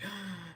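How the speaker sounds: sharp breathless gasp of shock. Retold or imitated. Gasping on hearing her mother knows. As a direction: gasp as if caught out. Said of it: "I was just like".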